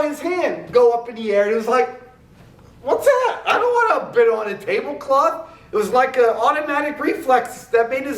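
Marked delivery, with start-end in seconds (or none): 2.8-5.5 high, startled shout of disbelief; shocked outcry. Acted. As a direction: yelp in alarm at his own raised hand. Hand goes up without meaning to.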